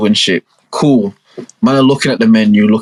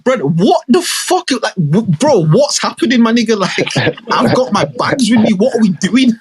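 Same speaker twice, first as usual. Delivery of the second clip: higher, baffled protest; exasperated self; 8 semitones higher than usual. Retold or imitated. Brother to sister, baffled and exasperated. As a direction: throw your hands up, bewildered. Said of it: "I'm looking at my sister now, like".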